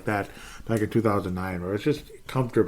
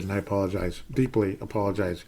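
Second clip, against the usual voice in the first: ordinary talk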